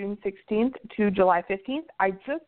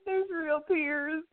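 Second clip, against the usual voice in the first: higher, slow and weepy from laughing; laughing to tears; 7 semitones higher, much slower than usual. Real, not acted. Co-host recovering from the host's slip, tearful with laughter. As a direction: draw it out, worn out by real giggles. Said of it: "I'm crying".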